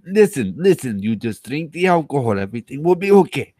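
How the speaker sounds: a loose put-on Russian accent, coaxing; mock Russian accent. Tipsy streamer coaxing in a mock Russian accent. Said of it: "Is that Japanese or Russian?".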